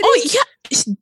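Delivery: high, loud "oh, yeah"; emphatic yes. Real, not acted. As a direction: insist it with a big swoop up. Emphatic agreement.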